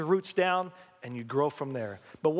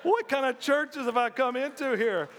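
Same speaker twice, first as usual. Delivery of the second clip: high-pitched, drawn-out mock bewilderment; acted astonishment; 8 semitones higher, slower than usual. Pastor recalling his first crushing hug at this church, mock astonished. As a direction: replay being stunned and overwhelmed. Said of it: "I'm like".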